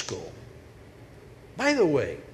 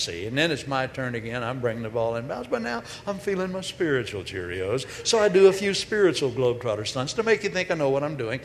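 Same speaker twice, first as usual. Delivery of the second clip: ordinary delivery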